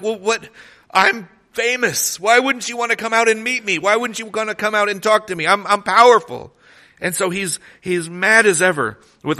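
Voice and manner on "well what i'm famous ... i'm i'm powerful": high-pitched huffy outrage at being snubbed; indignant general. Retold or imitated. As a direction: play the offended big shot. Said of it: "Naaman is indignant".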